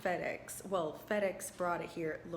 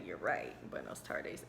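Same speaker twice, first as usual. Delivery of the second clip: a much lower, teasing copy of his "buenos tardes"; deep mock echo; 4 dB quieter than usual. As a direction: drop the voice way down and mimic him. Mock echoing the man's farewell.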